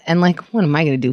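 usual speaking voice

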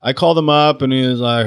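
ordinary delivery

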